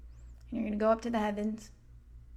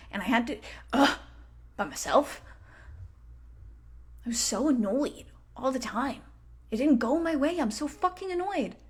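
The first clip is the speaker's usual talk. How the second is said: annoyed. Retold or imitated. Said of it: "And you can go".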